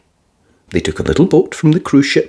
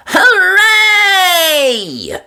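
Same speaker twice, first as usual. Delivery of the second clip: high cheering hooray; happy cheer; crowd cheer. A narrator's reading. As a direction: cheer with delight. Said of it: "Everyone cheered".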